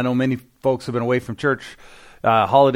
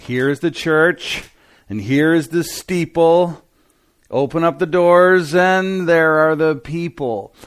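put-on slow, higher sing-song children's rhyme; childhood rhyme; 4 semitones higher, 3 dB louder, slower than usual. Pastor recalling a childhood rhyme, slow and sing-song. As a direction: recite it like a kid's finger game. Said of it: "It was like".

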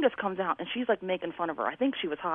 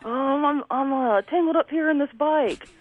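put-on drunk mom; 7 semitones higher, 3 dB louder, slower than usual. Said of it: "she's like".

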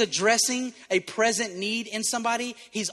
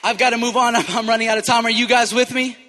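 loud, driving pulpit delivery; forceful preaching; 4 semitones higher, 11 dB louder than usual. Real, not acted. Preacher driving home the point, loud and forceful. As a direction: preach it out to the congregation.

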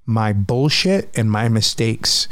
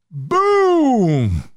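sudden boom; 12 semitones higher than usual. Real, not acted. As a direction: slam the word like an impact. Panic hits suddenly.

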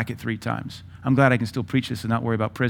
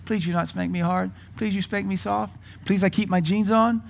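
put-on little boy pleading; 6 semitones higher than usual. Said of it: "He's like".

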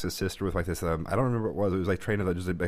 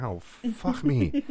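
drawn-out, higher "oh, fuck me" in mock despair; slow, pitched-up mock dismay; exasperated groan; 8 semitones higher, much slower than usual. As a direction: sigh it out like the weekend just died. Exasperated reaction to open houses.

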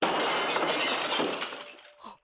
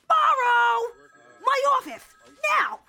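loud bossy call; shouting order; 3 dB louder than usual. Acted. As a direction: bark it across the office. Captain calling her in.

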